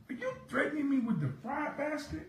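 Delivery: high, disbelieving question; incredulous. Retold or imitated. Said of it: "I said".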